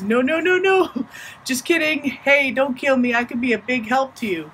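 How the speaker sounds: high-pitched frantic no's easing into bargaining; panicked pleading. Acted. Coyote begging Buffalo as the tree bends, panicked pleading. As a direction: act a trickster begging for his life.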